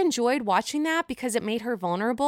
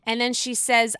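normal talking voice

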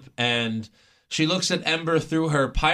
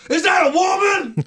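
high shouted announcer call; J R impression; 7 dB louder than usual. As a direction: yell it like a stunned commentator. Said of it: "he'd be like".

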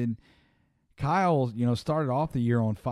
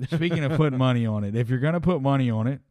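ad read; 3 dB louder, faster than usual. Acted. Podcast host starts sponsor read.

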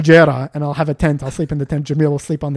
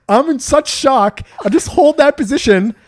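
excited; 9 semitones higher, 6 dB louder than usual. Real, not acted. Key finally opens trunk.

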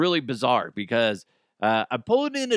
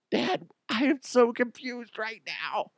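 higher, slow put-on child voice; confused kid; 8 semitones higher, 4 dB quieter than usual. Host's kid walking in on dad, mock confused child voice. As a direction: play a baffled kid, pitched up and drawn out. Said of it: "I hope my kids don't walk down here".

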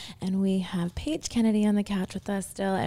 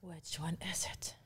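soft hushed question; whisper; 3 semitones lower, 18 dB quieter than usual. Acted. Host whispers during card draw.

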